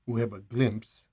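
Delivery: emphasis on the word "glimpse"; stress on glimpse. Real, not acted. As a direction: lean on "glimpse" so it stands out. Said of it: "I emphasize the word glimpse".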